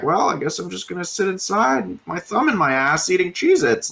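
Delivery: half-hearted mock whine of someone stuck inside; mock lazy slacker voice. Voicing a lazy pandemic person.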